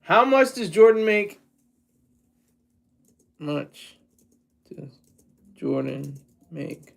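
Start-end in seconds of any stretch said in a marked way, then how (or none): 3.4-6.9 low, slow, half-murmured repeat of the question; quiet repeat. Shown as a demonstration. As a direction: mutter it under your breath, musing.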